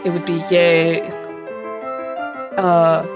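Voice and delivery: ordinary delivery, nothing marked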